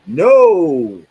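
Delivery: loud, high, drawn-out acted no; emphatic denial. Customer answering the bank's call, loud denial. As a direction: belt out the denial, stretched and loud.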